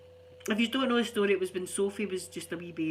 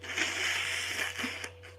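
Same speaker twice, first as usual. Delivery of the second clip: laugh. Real, not acted. Breathy laugh after.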